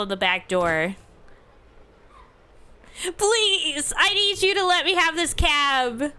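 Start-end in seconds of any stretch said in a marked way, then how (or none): 3.2-6.1 pleading stranger. Acted. Begging stranger at taxi.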